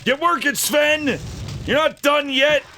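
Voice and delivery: high, hammed-up slave-driver yell; mock bossy yell. Streamer telling Sven he isn't finished, mock bossy yell. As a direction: yell orders at a worker in jest. Ordering game character to work.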